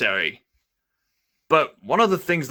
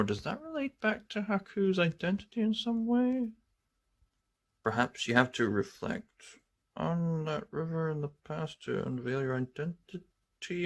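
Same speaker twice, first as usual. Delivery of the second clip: soft mysterious musing voice; mysterious hush; 2 semitones higher, 10 dB quieter than usual. Acted. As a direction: muse quietly and eerily.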